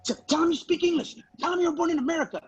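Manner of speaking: high, rushed mock panic; urgent, high-pitched fretting, acted out; panicked mom. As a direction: blurt it out like a panicking mother. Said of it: "went into full-on panic mode".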